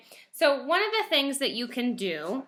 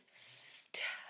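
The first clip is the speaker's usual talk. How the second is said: stuck breathed out slowly, no voice; slow whispered sounding out; 15 dB quieter than usual. Shown as a demonstration. Teacher to first graders, whispering stuck slowly. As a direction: stretch stuck into a soft whisper. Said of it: "So if I say it slowly".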